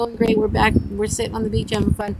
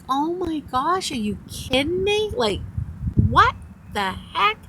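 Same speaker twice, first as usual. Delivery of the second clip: exasperated disbelief; 9 semitones higher than usual. Retold or imitated. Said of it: "this look on my face like".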